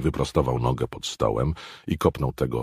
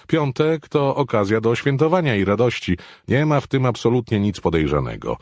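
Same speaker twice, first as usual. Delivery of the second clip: a lightly voiced, higher, louder dismissive man; dismissive Brockman; 11 semitones higher, 6 dB louder than usual. Brockman waving away the concern, lightly voiced dismissal. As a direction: brush the worry aside.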